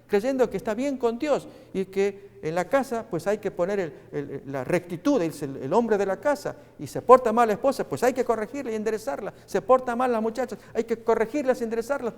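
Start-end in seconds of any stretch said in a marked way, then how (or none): none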